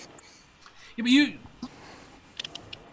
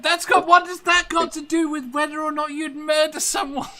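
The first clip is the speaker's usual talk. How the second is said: exasperated; 9 semitones higher than usual. Real, not acted. Exasperated at off-topic question.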